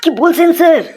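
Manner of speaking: high-pitched incredulous question; comic astonishment, voice jumping up; shocked disbelief. Acted. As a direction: ask it high, aghast at the answer. Teacher appalled by headmaster's mispronunciation.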